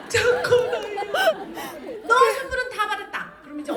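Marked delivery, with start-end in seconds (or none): 0.1-2.0 high, loud, slow mock wail; tearful child. Child answers mother's Santa question. 2.1-3.3 high, loud mock threat; threatening mother. Mother threatens no Santa presents.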